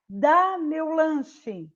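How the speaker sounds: DÁ said slow and strong, as an order; forceful dá. Shown as a demonstration. Teacher demonstrates the order with a strong dá. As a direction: stress the dá like a demand. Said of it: "eu estou falando ele com mais força".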